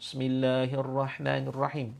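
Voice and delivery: ordinary delivery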